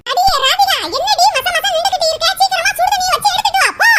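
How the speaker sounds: high-pitched impatient ordering; angry scolding. Acted. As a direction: scold your daughter into hurrying. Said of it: "என்ன இவ்வளவு கோவமா பேசுறாங்க".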